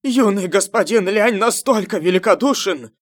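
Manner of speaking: high, loud fanatic cheering; joyful shouting. A narrator's reading. Crowd of followers hailing the young master, shouting for joy. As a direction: shout praise over a clapping crowd. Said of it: "Некоторые люди кричали от радости".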